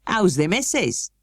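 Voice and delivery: friendly Yorkshire question, slow; broad Yorkshire. A narrator's reading. Station master asking after the master's wife in Yorkshire. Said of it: "pronouncing his words in a queer, broad fashion".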